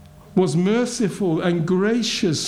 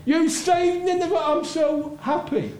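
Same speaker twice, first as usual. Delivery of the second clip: higher, gushing mock thanks to God; ironic, lightly hammed jubilation; mock joyful praise; 7 semitones higher than usual. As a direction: play the happy prophet he never was. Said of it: "Was Jonah going".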